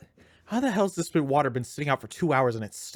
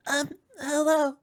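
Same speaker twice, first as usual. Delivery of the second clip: put-on very high, slow little girl voice; little girl's voice; slower than usual. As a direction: pitch way up for a small girl. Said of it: "How am I gonna do this little girl's voice?".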